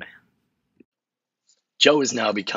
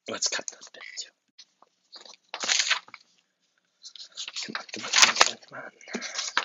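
hushed unvoiced urging; whispered aside; 2 semitones higher, 6 dB quieter than usual. Scrolling for a usable quote, whispering to himself. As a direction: whisper while hunting through notes.

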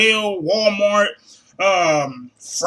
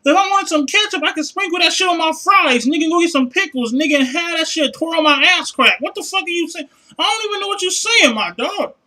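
higher-pitched ranting disbelief; heated comic rant; 6 semitones higher than usual. Real, not acted. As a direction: go off on them, worked up. Ranting at the rep.

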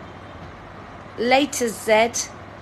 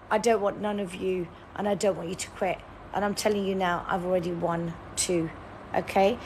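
ordinary delivery

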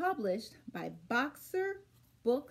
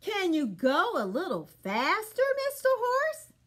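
eager animals; 6 semitones higher, 4 dB louder than usual. Acted. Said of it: "asked Cat and Dog".

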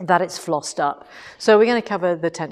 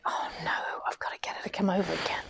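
whispered thought; 11 dB quieter, much faster than usual. Retold or imitated. Inner panic, thinking to herself.

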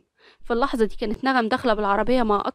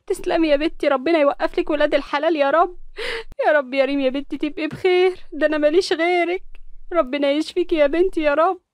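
a hint of an old mother praying, higher; lightly voiced pleading mother; mother praying for her sick daughter; worried mother; 5 semitones higher than usual. A narrator's reading.

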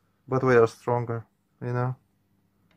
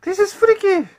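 freaked-out squeal; 7 dB louder than usual. Real, not acted. Scared by horror game scene.

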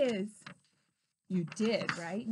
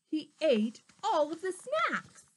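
ordinary delivery

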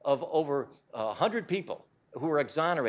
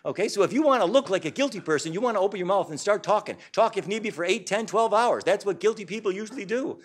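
mock advice; 6 semitones higher, 4 dB louder than usual. Real, not acted. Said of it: "mocking advice to look guilty".

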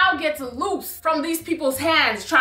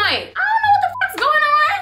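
bewildered exasperation; 12 semitones higher than usual. Retold or imitated. Confused mid-fight at the club.

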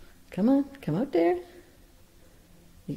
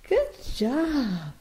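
higher, brighter praise for the bird; 5 semitones higher than usual. Real, not acted. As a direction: lift it up to cheer him on. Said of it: "praise repeated, soft then high".

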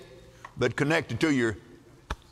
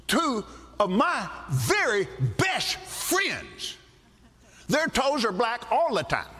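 defiant answer to devil; 8 semitones higher than usual. Retold or imitated. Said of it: "I said".

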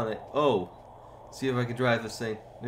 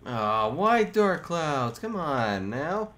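slow whine; 3 semitones higher, much slower than usual. Real, not acted. Despair at storm clouds.

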